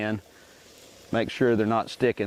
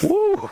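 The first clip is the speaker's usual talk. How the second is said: sharp high woo as fumes hit; high whoop; 5 dB louder than usual. Real, not acted. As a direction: yelp a loud, high woo. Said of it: "Whoa, it'll get you".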